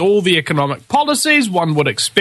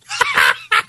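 high laugh. Real, not acted. Laughs at socialists resisting.

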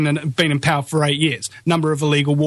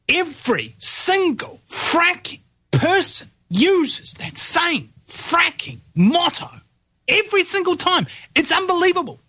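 worked-up rant; 12 semitones higher, slower than usual. Real, not acted. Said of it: "let me just, just calm down for a second here".

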